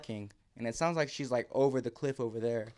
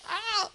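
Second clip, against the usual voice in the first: sharp high cry of pain; scream of pain; 4 dB louder than usual. Retold or imitated. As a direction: yelp as the finger snags. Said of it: "and I scream out".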